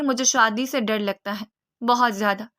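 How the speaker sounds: usual speaking voice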